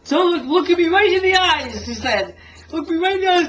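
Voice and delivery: a hint of a worried dad demanding the truth; stern dad. Father grilling son after gig.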